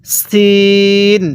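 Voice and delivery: সিন with a thin, sharp s and long ee; drawn-out thin-s সিন; thin S sound. Shown as a demonstration. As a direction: stretch সিন as "seeen" on a level note. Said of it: "চিকন করে উচ্চারণ করতে হবে".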